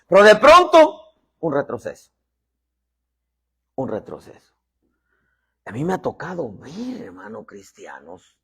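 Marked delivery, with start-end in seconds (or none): 0.1-1.0 loud, high jolt; sudden dramatic outburst. Real, not acted. Preacher warning of a sudden setback, dramatic outburst. Sudden turn after building list. 1.4-4.5 dropping down after the outburst; sudden letdown. Real, not acted. Preacher naming the setback after progress, sudden letdown.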